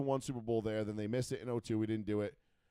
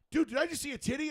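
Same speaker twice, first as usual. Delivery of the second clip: excited disbelief; 14 semitones higher, faster than usual. Retold or imitated. Friend's retold excited question.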